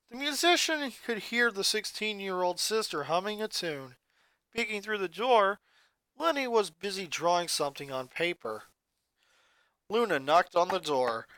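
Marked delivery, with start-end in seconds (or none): none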